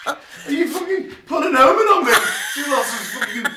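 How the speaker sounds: high, loud, laughing indignation; laughing outrage. Real, not acted. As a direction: protest while cracking up. Teasing gift, both laughing.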